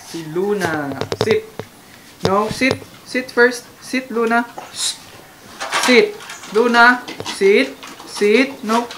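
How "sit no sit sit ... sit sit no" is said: loud, higher commands to the dog; firm commands. Real, not acted. Owner ordering Luna to sit, loud and firm. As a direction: bark the order firmly.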